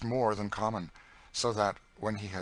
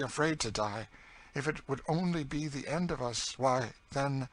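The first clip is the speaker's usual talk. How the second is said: raised, bitter voice easing off; higher, world-weary story voice; despairing older captive character; 7 semitones higher, 4 dB louder than usual. Acted. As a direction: argue hard, then trail into despair. Cassie on fear of death.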